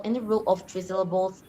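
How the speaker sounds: usual speaking voice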